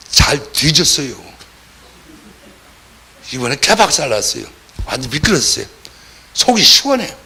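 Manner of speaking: hammed-up spiteful satisfaction; mock gloating. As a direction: imitate smug glee at someone's downfall. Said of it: "하나님은 그 음성을 듣기를 원할까요?".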